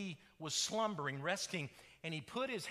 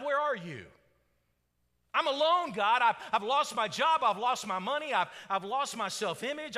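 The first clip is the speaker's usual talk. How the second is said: raised, high lament to God; desperate plea; 4 semitones higher, 6 dB louder than usual. Acted. Suffering believer alone asking God for answers, desperate plea. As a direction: pile up the troubles, loud and pleading.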